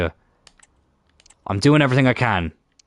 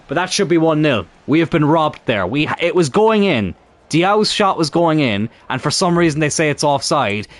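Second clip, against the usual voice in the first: higher, exasperated protest at a bad offside call; frustrated outburst; 7 semitones higher than usual. Real, not acted. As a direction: pitch it up in real disbelief. Robbed by offside glitch again.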